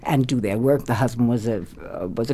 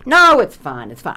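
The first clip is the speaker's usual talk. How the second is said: brushing it off; 5 semitones higher, 8 dB louder than usual. Retold or imitated. Director brushing off her plea.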